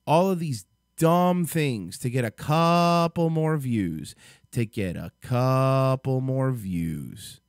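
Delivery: slow, long-stretched key words; exasperated. Real, not acted. Vlogger disgusted by stunts done for views, exasperated.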